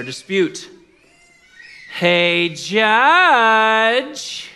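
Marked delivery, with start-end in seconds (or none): 2.0-4.5 loud, long-drawn "judge" rising high; pleading widow's call. Acted. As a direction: holler it across a courtroom as the widow. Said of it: "'cause that's how she would say it".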